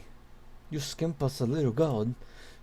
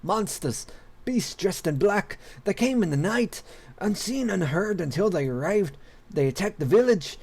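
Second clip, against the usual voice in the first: higher, urgent frightened report; alarmed villager; 6 semitones higher than usual. Acted. Villager begging the king for help, alarmed higher voice. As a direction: blurt out the horror to the king.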